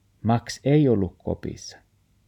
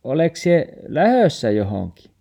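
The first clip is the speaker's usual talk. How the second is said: higher, louder worried asking; grandma asking, higher and louder; concerned question; 7 semitones higher, 6 dB louder than usual. Acted. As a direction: ask gently if he's leaving. Grandma asks the sad dog.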